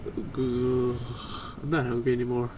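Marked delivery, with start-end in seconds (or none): none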